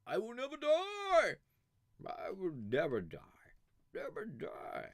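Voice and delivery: high, hammed-up vow; theatrical battle cry; mock immortal boast. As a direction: proclaim like a deathless hero. Declaiming as immortal.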